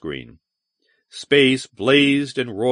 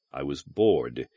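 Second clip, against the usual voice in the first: sullen; 9 semitones lower, 5 dB quieter than usual. A narrator's reading. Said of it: "he replied sullenly".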